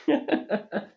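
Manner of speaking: a quick high burst of laughter; short laugh. Real, not acted. Gamer laughing about nearly crying over a game, short laugh. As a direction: laugh at your own line. Laughs at his own joke.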